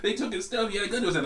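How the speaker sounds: high-pitched imitation of a freaked-out girl; mock alarm, pitched well up. Retelling the church girl's reaction to the robbery, freaked out and high. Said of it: "She was all freaked out about it".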